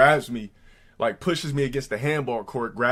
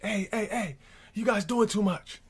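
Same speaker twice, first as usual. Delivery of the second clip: duty guard imitation; 10 semitones higher than usual. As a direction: imitate a scolding school guard in a higher pitch. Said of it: "the duty guards would be like".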